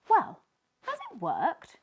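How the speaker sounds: high, loud, eager question; can't wait to know. Acted. Tiny eagerly asking Sneezy if it worked. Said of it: "asked Tiny eagerly".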